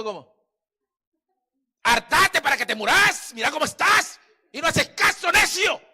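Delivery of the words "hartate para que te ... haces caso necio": high, loud scolding shout; nagging scolding. Retold or imitated. Spouse yelling at partner about overeating. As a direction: berate him like a furious spouse. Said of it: "Eso no es exhortación".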